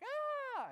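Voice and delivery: cartoonish startled shriek; mock scream. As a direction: jump out with a squeal. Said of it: "being like".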